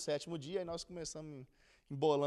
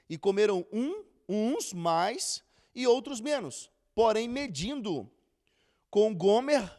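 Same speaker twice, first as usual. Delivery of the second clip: scripture reading; 2 semitones higher, 8 dB louder than usual. Acted. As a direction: read the verses out with weight, unhurried. Reads Exodus passage aloud.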